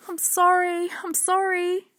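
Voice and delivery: high, urgent repeated apology; pleading child. A narrator's reading. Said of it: "Hannah kept saying".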